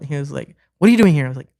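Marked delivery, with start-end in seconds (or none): 0.8-1.2 louder, higher startled question; the friend, startled, asks loudly why he is there; surprised. Retold or imitated. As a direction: sound caught off guard by the visit.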